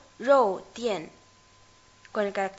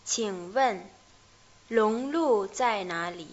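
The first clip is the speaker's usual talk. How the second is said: slowly; slower than usual. Shown as a demonstration. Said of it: "vamos a ver lentamente este diálogo".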